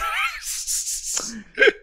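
high-pitched burst of laughter. Real, not acted. Laughs at own joke.

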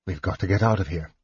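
hushed, quick, low thought; inner thought. A narrator's reading. Leader fighting down panic, hushed urgent thought. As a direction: think it fast under the breath. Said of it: "he thought, fighting down panic".

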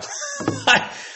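squealing laugh; high shriek of laughter. Real, not acted.

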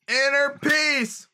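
high, loud rally shout; rousing union rally. Pretend. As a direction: yell it back like a picket line. Protest chant response.

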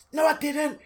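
put-on high Irish old lady scolding, pitched well up. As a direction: nag like an angry little Irish granny. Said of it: "Irish accent".

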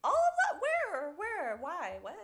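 high, baffled squeaks of disbelief; a fan baffled by the news, squeaking; confused squeal. Retold or imitated. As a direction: squeak out the questions, lost. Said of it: "And you're just like".

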